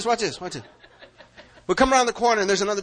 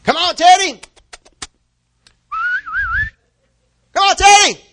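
high, loud call across the yard; calling the dog; 12 dB louder than usual. Retold or imitated. Owner calling the dog home, loud high call. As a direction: holler for the dog to come.

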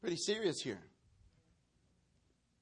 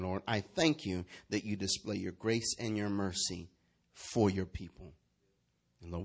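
low, measured pastoral prayer; 6 semitones lower than usual. Real, not acted. Pastor thanks God for his word, praying. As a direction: pray it out low and deliberate.

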